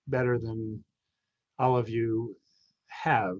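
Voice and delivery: ordinary delivery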